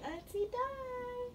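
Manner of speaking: high, slow and drawn out; sing-song prompt for a newborn; coaxing baby to say it. Shown as a demonstration. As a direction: stretch it up high to coax the baby.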